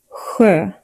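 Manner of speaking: "Sjö" with a soft windy whoosh from the throat; Swedish sj sound. Shown as a demonstration. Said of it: "from deeper in your throat, like a soft, windy whoosh".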